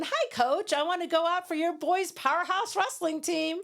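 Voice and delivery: high, bright-eyed and hopeful, asking the coach; skinny cheerleader politely asking to join the boys' team; eager cheerleader girl. Retold or imitated. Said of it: "I'm this skinny girl in, in this cheerleading outfit and going".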